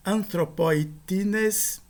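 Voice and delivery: anthropoitines said very slowly, syllable by syllable; drawn-out anthropoitines for the class; careful Greek demo. Shown as a demonstration. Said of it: "De novo, é uma palavra proparoxítona". (anthropoitines pronunciado bem devagar)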